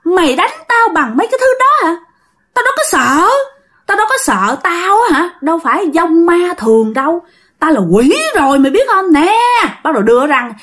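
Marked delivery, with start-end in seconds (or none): 0.0-9.4 high-pitched demon talking back; defiant spirit. Acted. Spirit defies the uncle's mulberry branch, high indignant retort. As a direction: sneer at the threat, pitched way up. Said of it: "nó mới cự lại".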